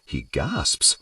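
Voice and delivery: plain reading voice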